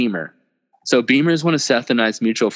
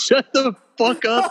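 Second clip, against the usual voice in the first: laughing; 12 semitones higher than usual. Retold or imitated. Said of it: "And I was like".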